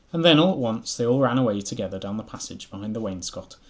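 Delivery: story reading. A narrator's reading.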